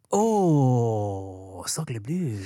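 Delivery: low mock French exclamation; mock French dismay. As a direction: gasp it like a cartoon Frenchman.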